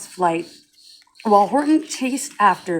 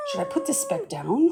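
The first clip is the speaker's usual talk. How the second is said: high, hushed worried question; alarmed; 6 dB quieter than usual. A narrator's reading. Horton alone with the speck, hushed and high with alarm. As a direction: ask it softly, alarmed. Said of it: "Horton thought with alarm".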